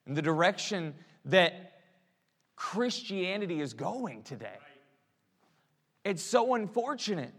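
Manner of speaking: usual speaking voice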